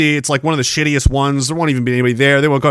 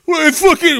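rowdy taunt; 11 semitones higher than usual. Retold or imitated. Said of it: "and we're like".

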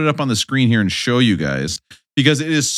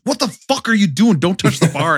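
mock yell from the wife, pitched higher; retold angry yell; 7 semitones higher than usual. Wife yelling at husband over a bad spot, mock angry yell. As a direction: snap at him like an annoyed wife. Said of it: "and she goes".

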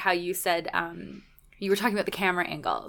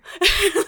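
a high burst of real laughter; loud laugh; 12 semitones higher, 7 dB louder than usual. Real, not acted. Cheer coach laughing at her team's weak mat talk, loud laugh. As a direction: crack up at the memory.

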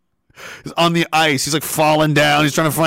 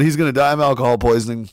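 normal talking voice

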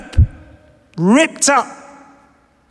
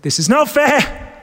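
Job's protest; 4 semitones higher, slower than usual. Retold or imitated. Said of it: "He's saying".

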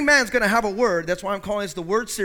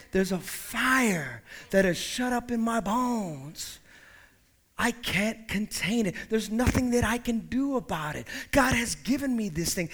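hushed testimony; 5 dB quieter than usual. Acted. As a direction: let the fire smoulder quietly. Quiet repeat of Jeremiah quote.

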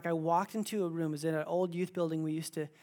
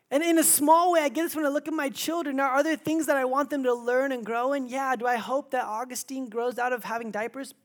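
preaching at full force; 8 semitones higher, 7 dB louder than usual. Acted. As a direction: proclaim it to the whole room, building.

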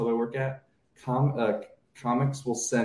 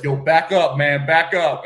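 mock tough warning; 2 semitones higher, 8 dB louder than usual. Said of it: "they were like".

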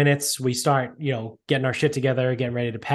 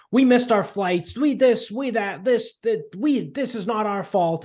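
mock flipping out; 8 semitones higher than usual. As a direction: whine it out like a frantic old man, much higher. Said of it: "the old dude's, like, flipping out".

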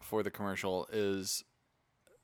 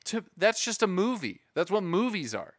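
louder, higher exasperation; exasperated; 7 semitones higher, 7 dB louder than usual. Real, not acted. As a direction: rant in disbelief. Exasperated rant about DC.

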